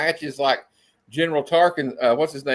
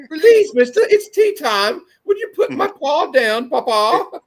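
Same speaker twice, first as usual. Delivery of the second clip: put-on high, loud, pleading English child; very high English urchin voice; mock British kid; 11 semitones higher, 4 dB louder, slower than usual. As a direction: ham up a little boy's British accent. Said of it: "from Great Britain?".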